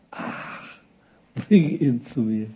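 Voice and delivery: long airy sigh, then slow resignation; sigh, then give the order wearily; acted, worn-out patience; weary sigh. Sighs as weary Jesus, repeats command.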